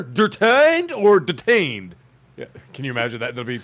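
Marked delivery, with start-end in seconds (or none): 0.0-1.9 "Detained" slowly, two different ways, pitched up; contrasted pronunciations. Pretend. Husband quizzing the judge on pronunciation, slow contrasting "detained". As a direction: exaggerate the first "detained", then contrast it. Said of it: "how did you say that".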